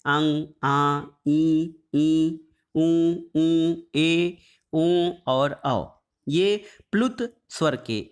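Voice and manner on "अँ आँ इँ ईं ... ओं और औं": each vowel from आ to औ held long through the nose; sustained nasal vowel demonstration; nasalised vowels. Shown as a demonstration. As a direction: recite the vowels one by one, drawn out with nasalisation. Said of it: "इन स्वरों का अनुनासिक उच्चारण भी होता है".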